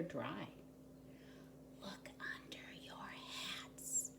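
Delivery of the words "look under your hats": hushed, slow whisper; a mysterious woman whispers to the puzzled townspeople. A narrator's reading. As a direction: breathe it like a secret. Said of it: "she whispered".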